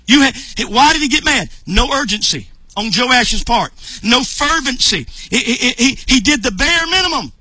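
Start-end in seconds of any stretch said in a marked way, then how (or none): none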